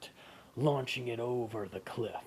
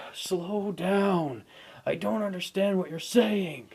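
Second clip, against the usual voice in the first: exasperated hero; 8 semitones higher, 7 dB louder than usual. Acted. Hero pleading.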